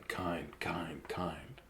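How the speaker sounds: breathy whispered repeat of kind; whispered echo. Acted. Reader echoing kind in a spooky whisper. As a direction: hush the word down to a whisper.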